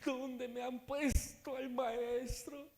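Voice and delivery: high, drawn-out weeping voice; mock tearful wail. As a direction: wail it slowly as if crying, high-pitched.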